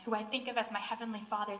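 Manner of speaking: ordinary talk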